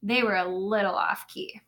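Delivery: put-on lively storybook narration for little kids; animated read-aloud; reading to children. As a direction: read it like story time in class. Teacher reads picture book aloud.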